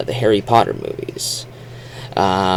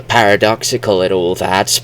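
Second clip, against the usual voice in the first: ordinary delivery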